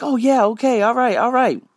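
high, breezy mock agreement; imitating Luke. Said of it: "And he's like".

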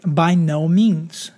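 "by no means" slow and weighty, each word given its own stress; emphatic. Real, not acted. Preacher to congregation, giving the catechism's emphatic answer. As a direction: lean hard on "by no means", drawn out. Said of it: "The answer is an emphatic".

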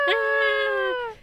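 high-pitched pretend scream; mock scream. Host acting out the monster attack, high mock scream. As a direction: squeal in fake terror, very high.

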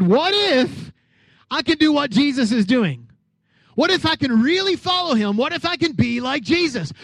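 loud, full-force sermon voice; preaching. Acted. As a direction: preach it out to the whole church. Preaching Peter's daring thoughts.

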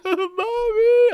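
very high, loud mock cry; wailing toddler. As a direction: wail for mommy like a toddler.

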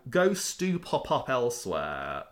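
ordinary talk, nothing marked

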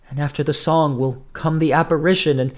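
high, hesitant, mildly fearful; halting dread. A narrator's reading. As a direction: falter as if afraid to finish. Julia haltingly reveals her dread.

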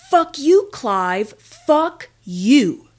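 furious shout up at a building; screaming in rage. Retold or imitated. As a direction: yell it out with all your rage. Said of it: "I'm screaming towards the top of his building".

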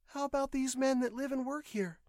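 high-pitched female voice; questioning young woman. A narrator's reading. Patient asks nurse about the workers, questioning. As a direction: raise it well up and query.

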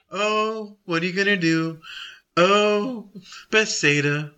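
limp, resigned sighing tone; feebly lament, with drawn-out "oh"s; mock mild lament. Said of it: "this is not like".

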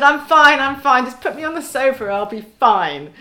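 acted-out protest that all is well; insistent reassurance. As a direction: wave them off, insisting too hard. Acts out reassuring family while hurt.

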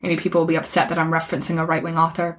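usual speaking voice

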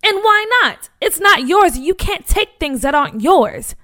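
high, loud and righteous, easing off at the end; indignant protest. Acted. Boy telling the bullies they can't take his things, indignant. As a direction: stand your ground and tell him he's wrong.

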